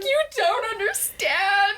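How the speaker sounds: high, drawn-out tearful wail; hammed-up crying protest, stretched out slowly; mock bawling. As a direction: whine it through pretend sobs. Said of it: "I just started bawling".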